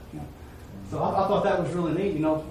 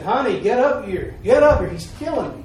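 retold scream for help; 5 semitones higher, 7 dB louder than usual. Stabbed man calling his wife upstairs, mock yell. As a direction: call for help, rising and louder. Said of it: "he screamed at his wife".

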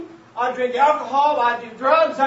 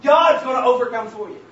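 loud, fervent sermon climax; preaching at full force; 3 semitones higher, 5 dB louder than usual. Real, not acted. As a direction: drive it home to the congregation.

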